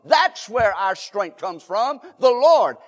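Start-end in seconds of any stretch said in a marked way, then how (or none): 2.2-2.8 exclaim. Real, not acted. Preacher exclaiming the answer.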